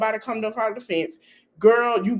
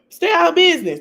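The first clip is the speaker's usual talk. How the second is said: mocking quote; 8 semitones higher, 7 dB louder, faster than usual. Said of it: "She told everybody".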